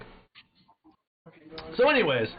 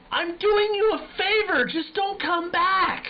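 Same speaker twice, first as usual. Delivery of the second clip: mock yell; slower than usual. Retold yell at crack smokers.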